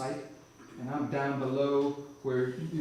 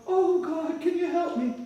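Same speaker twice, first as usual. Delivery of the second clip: much higher, mocking little whimper; high, mocking imitation of a helpless plea; God mimicking a whine; 14 semitones higher than usual. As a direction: parrot his feeble plea back at him. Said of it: "This voice says".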